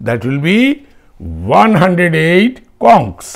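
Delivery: slow dictation. Shown as a demonstration. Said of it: "dictating plural form".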